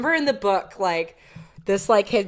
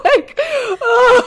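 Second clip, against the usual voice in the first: high laughing squeal; giggling in disbelief; 14 semitones higher, 5 dB louder than usual. Real, not acted. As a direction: laugh through the words. Laughing at the cringey line.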